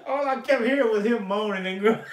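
words squeezed out through high, loud laughter; laughing while talking. Real, not acted. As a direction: talk while you can't stop laughing. Talking through laughter about fall.

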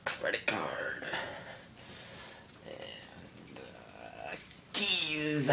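whispered checklist; whispering. Real, not acted. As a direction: whisper it to yourself. Listing things under his breath.

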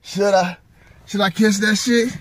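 inner debate. Retold or imitated. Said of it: "I was thinking in my head".

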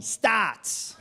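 Starts shouted out in a broad Australian accent, vowel drawn out; Aussie accent. Shown as a demonstration. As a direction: belt out Starts like a true Aussie. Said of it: "Say it like an Aussie".

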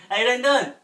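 normal talking voice

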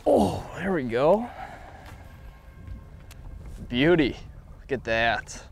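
ordinary talk, nothing marked

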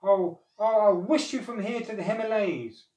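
high, loud furious outburst; snapped threat. A narrator's reading. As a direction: threaten angrily, stumbling at the start. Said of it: "the old man snapped".

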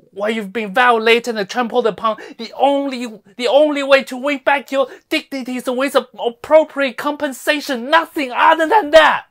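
loud, high tirade building at the end; impassioned demand. Acted. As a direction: hammer the demand home forcefully. Fiery demand for compensation.

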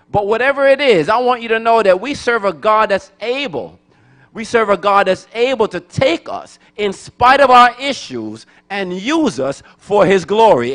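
usual speaking voice

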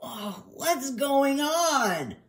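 slow, high, dazed moan; groggy groan. Acted. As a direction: drag the words out, confused. Injured dog just waking up.

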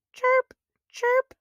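very high squeaky chirping; high cricket chirp. Acted. Reading to kids, squeaky high cricket chirps. Imitating insect sounds.

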